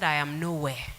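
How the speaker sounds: low, very soft, falling away; a small, subdued voice retelling a child's words; dejected child's admission. Retold or imitated. Daughter admits to parent she feels worthless, dejected.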